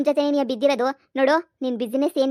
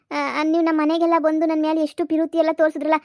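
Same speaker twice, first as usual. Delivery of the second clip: ordinary talk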